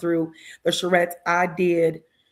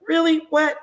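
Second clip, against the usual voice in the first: surprised disbelief; 10 semitones higher, 3 dB louder than usual. Retold or imitated. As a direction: pitch jumps way up in retold disbelief. Senators reacting in disbelief.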